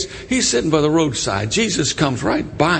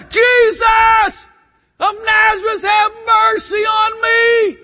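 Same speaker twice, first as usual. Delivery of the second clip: high, loud drawn-out cry for mercy; desperate holler; 5 dB louder, slower than usual. Acted. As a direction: holler it out at full voice. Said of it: "he hollers".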